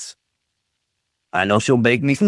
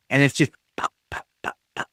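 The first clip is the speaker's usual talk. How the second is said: normal talking voice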